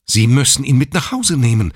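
insist, leave no room; insistent. A narrator's reading. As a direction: nurse insisting to the father. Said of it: "beharrte die Schwester".

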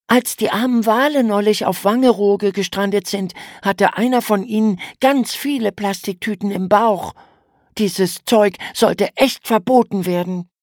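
hohe Mädchenstimme, empört über Plastikmüll; höher gesprochen, entrüstet schimpfend; kleines Mädchen schimpft. A narrator's reading. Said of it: "und schimpfte". (hohe Kinderstimme, empört schimpfend)

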